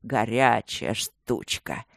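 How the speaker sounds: slow, low purr of approval; low lecherous drawl; leering praise. A narrator's reading. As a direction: drag it out appreciatively. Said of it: "похвалил он".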